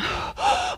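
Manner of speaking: sharp, high acted gasp; imitated gasp of panic; panicked gasp. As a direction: suck in air as if alarmed. Acting out panicking boy.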